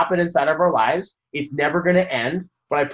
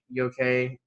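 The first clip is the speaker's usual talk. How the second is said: calm check-in; 3 semitones lower, 6 dB quieter than usual. Retold or imitated. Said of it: "Like, energy as well".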